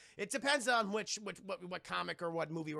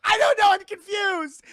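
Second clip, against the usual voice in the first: very loud, high-pitched acted outburst; flustered scream; 13 semitones higher, 18 dB louder than usual. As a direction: scream it in hammed-up confusion. Retold flustered outburst before running away.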